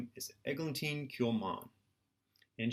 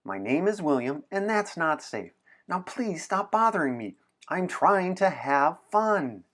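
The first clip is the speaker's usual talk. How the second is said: higher, louder, slowing at the end; exasperated; 5 semitones higher, 5 dB louder than usual. Acted. William scolding Anna for bothering him, exasperated. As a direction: snap back in frustration, dragging the last line.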